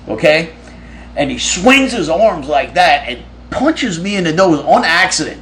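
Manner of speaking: ordinary delivery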